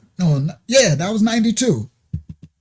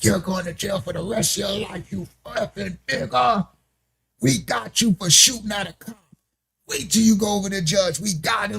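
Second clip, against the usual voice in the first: higher, softer put-on cop voice; mock threatening cop; 4 semitones higher, 6 dB quieter than usual. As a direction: imitate a taunting officer, voice raised in pitch but held back. Acting out the arresting cop's threats.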